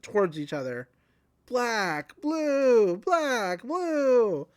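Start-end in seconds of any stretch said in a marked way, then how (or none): 1.5-4.5 mock screaming names.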